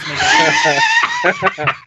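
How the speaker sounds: Nagire squealed high through laughter; stretched laughing Nagire, very slow; laughing backwards name. Shown as a demonstration. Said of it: "Don't say that backwards".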